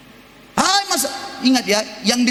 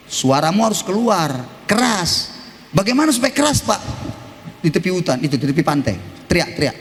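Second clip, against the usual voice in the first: usual speaking voice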